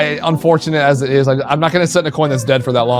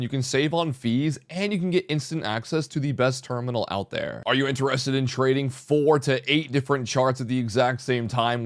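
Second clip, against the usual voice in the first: soft, smooth ad read; sponsor ad read; 9 dB quieter than usual. Acted. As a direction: read the sponsor spot quietly.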